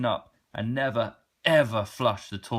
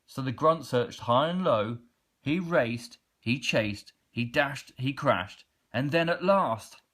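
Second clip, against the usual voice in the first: usual speaking voice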